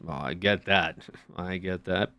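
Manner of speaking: low, soft, subdued agreement; night-store small talk. Retold or imitated. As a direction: lightly act a low-key night shopper commiserating. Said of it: "when I go to the store at night".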